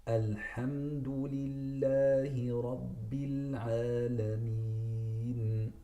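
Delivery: "Alhamdulillahi Rabbil Alameen" very slow; correct slow recitation. Shown as a demonstration. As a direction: recite each letter slowly and clearly.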